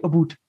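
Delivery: "a boot" with an oo vowel, for about; Newfie pronunciation. Retold or imitated. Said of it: "the ones that say".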